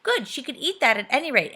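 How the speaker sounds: usual speaking voice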